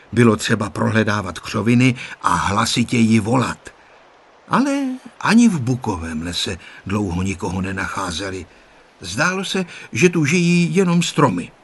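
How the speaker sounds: ordinary delivery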